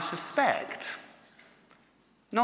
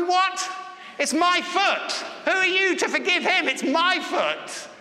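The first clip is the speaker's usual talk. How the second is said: very high-pitched mock outrage; hammed-up indignant objection; indignant outburst; 13 semitones higher than usual. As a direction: protest in disbelief, voice shooting up. Acted outrage at stranger's forgiveness.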